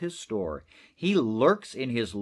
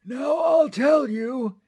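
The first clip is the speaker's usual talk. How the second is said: put-on higher, louder, slow rasping old voice; gray-toothed Onceler; 11 semitones higher, 5 dB louder, slower than usual. Onceler beginning his tale to the listener, slow and loud. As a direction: drag it out like an old hermit. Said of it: "with his teeth sounding gray".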